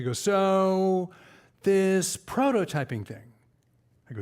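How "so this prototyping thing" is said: slow, drawn-out puzzled voice, lightly put on; imitating a slow audience guy. Man in the audience slowly puzzles over prototyping.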